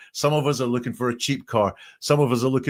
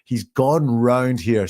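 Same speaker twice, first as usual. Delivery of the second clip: ordinary talk, nothing marked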